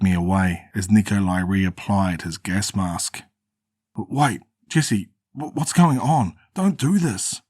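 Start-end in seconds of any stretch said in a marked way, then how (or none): 4.0-7.4 rising higher and higher, a desperate plea; panicked pleading. Acted. Austin begs his betrayer Jesse while being dragged away, panicked pleading. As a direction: beg him, voice climbing in panic.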